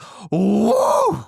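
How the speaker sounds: a sudden high whoop like the creature in the woods; imitated howler whoop. Telling friends about the night noise, imitated howler whoop. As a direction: mimic the wild animal's whoop. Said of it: "is what it sounded like".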